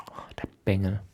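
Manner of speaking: leise geflüstert, genervt; geflüstertes Murren. Acted. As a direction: murr es kaum hörbar vor dich hin. Murren über den Jungen. (genervt geflüstert)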